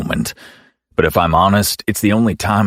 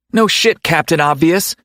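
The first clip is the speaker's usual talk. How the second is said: yelling; 11 semitones higher than usual. Acted. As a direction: snap it back in a yell. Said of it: "she yells at me".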